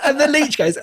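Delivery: normal talking voice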